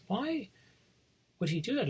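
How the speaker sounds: high, puzzled child's question, lightly voiced; little girl asking. Retelling the girl in the film asking the storyteller, high childlike voice. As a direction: ask it like a curious little girl. Girl's question in retold scene.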